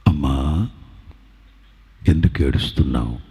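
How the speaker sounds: very deep, measured divine voice; solemn low-pitched question to a weeping woman; voice of Jesus. Acted. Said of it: "యేసు ప్రభు స్పష్టంగా అడుగుతున్నారు".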